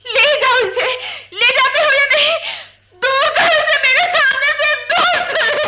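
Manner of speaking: high, loud, tearful screaming; furious crying shout. Acted. Widow screaming at the villager to remove the killer. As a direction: drive him out, voice cracking with tears.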